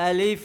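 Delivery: low, even আলিফ with a long hissed final f; correct আলিফ. Shown as a demonstration. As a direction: end আলিফ on a clear labiodental f. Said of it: "উপরের দাঁত নিচের ঠোঁটের সাথে".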